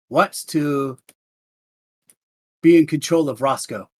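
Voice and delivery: usual speaking voice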